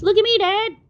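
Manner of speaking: put-on high, loud child's call; a little one calling to his dad for attention; excited little tadpole. Tad shows off to his dad, high and loud.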